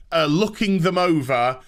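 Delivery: ordinary delivery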